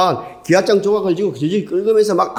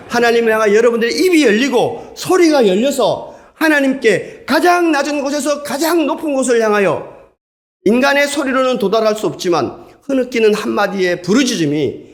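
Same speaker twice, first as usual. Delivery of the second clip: higher, rising fervent preacher's cry; impassioned preaching; 8 semitones higher than usual. Acted. Preacher urging the congregation to cry out to God, impassioned. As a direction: lift the congregation's voices toward God with fervor.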